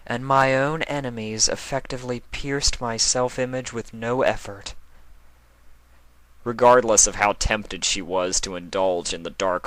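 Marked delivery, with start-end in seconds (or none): 0.1-4.7 a touch of weary Luna voice, higher and lightly voiced; dreary inner thought, lightly put on; drearily. A narrator's reading. Luna thinking to herself, a lightly voiced dreary sigh. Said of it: "she thought drearily".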